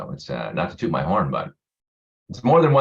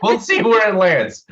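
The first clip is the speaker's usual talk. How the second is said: playful laughing; 14 semitones higher than usual. Real, not acted.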